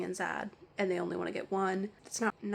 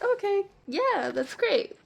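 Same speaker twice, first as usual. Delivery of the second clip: higher, drawn-out sarcastic okay yeah; 11 semitones higher, 4 dB louder, slower than usual. Retold or imitated. Clerk answering the customer who joked about buying five birds, slow sarcasm. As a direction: fake agreement, dripping with sarcasm.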